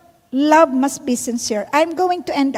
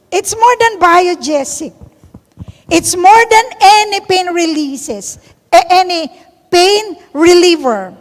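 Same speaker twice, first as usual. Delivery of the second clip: raised, louder preaching voice; emphatic preaching; 5 semitones higher, 7 dB louder than usual. Real, not acted. Preacher proclaiming to the church, louder and raised. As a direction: proclaim it to the congregation.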